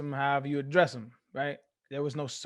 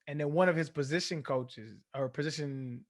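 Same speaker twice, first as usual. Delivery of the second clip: normal talking voice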